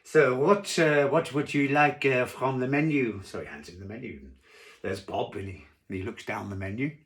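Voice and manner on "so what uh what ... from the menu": louder, higher French waiter; French waiter accent. Acted. Waiter takes a diner's order, in a French accent. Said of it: "'cause he was French".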